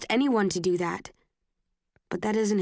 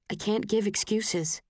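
usual speaking voice

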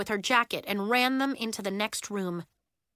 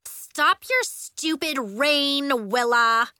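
slow, loud, nasty yell; high, angry kid's shout; 5 semitones higher, 6 dB louder, slower than usual. A narrator's reading. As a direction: yell it at the culprit. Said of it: "yelled horrid Lacey Clench".

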